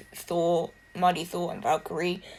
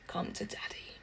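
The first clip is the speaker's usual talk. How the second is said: breathy whispered coaxing; 16 dB quieter than usual. Retold or imitated. Thor coaxing his old hammer Mjolnir, in a whisper. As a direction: whisper it like luring a pet. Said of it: "Thor was going".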